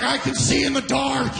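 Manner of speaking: high, strained put-on boast; crazed welder impression. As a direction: imitate a wild-eyed tradesman boasting. Voices the glossy-eyed welder.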